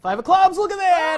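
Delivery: high, loud triumphant reveal; mock amazed shout. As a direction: shout it like it's a miracle. Celebrates the forced card.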